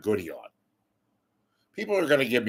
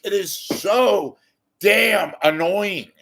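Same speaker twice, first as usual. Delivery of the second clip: slow, high, loud exasperation; exasperated; 8 semitones higher, 6 dB louder, slower than usual. Real, not acted. Host fed up with a newscaster's cadence, exasperated emphasis. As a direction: drag out each word, fed up.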